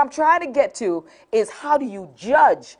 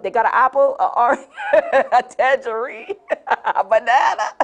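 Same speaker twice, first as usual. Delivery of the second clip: laughing mock list. Real, not acted. Mocking fruit list, laughing.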